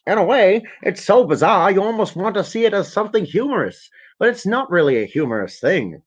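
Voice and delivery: lightly voiced mock Bill Gates; quoted Gates.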